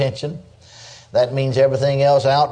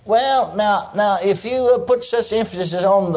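higher-pitched, hesitant minister imitation; lightly mocked hemming and hawing in a higher voice; objecting minister; 6 semitones higher than usual. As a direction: mimic a minister haltingly raising an objection. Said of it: "so a minister said to me".

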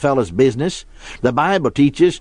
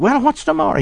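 put-on high, insistent child's question; three-year-old son; 10 semitones higher than usual. Son pestering his father at bedtime, childlike insistence. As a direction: imitate a small boy who will not drop it. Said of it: "our son was just three years old".

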